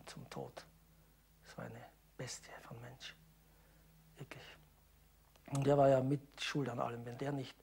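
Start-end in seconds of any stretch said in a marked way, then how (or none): 0.1-4.4 hushed, breathy whisper. Real, not acted. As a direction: drop to a whisper. Elderly witness lowers voice.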